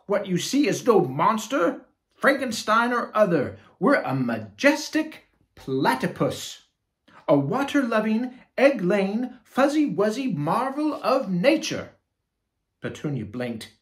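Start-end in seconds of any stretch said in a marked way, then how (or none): 0.1-12.0 much higher, louder proud quacky voice; platypus voice. Acted. Platypus introducing itself to the party, proud quacking. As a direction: announce yourself grandly, quacking. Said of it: "quacked the party guest".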